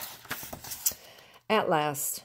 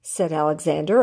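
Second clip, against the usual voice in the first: usual speaking voice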